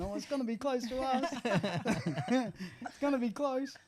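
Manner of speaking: high, anxious inner voice; worried thought. Retold or imitated. Man retelling his worry at the shops, high anxious voice. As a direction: squeak it out like a nervous thought.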